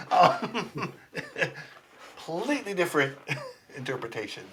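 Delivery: laughing through the words; chuckling. Real, not acted. Amused by puto's two meanings, chuckling as he talks.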